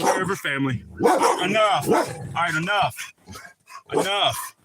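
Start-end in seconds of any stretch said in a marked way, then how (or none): 1.4-4.6 stern shout at dogs. Real, not acted. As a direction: bark the order to stop them.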